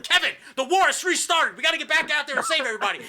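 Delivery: high, loud mock-heroic shout; hammed-up urgent rallying cry. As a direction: burst in and rally an old warrior. Acted bursting in to rally warrior.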